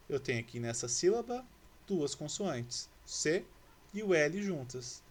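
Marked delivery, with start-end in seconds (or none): none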